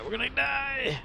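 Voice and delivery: high, hammed-up doom wail; mock panic cry. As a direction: wail it like all is lost. Mock despair over chat numbers.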